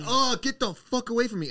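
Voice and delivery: high-pitched retold outburst of disgust; disgusted recoil. Storyteller recoiling from a crackhead's spit, high disgusted yelp. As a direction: recoil and push them off, voice jumping up.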